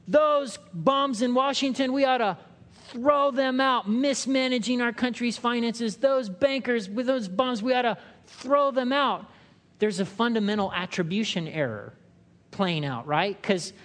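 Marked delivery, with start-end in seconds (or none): 0.0-9.2 mock outraged grumbling. Preacher mimics Americans ranting about Washington. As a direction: rant like an indignant citizen. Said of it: "all of us in America are going".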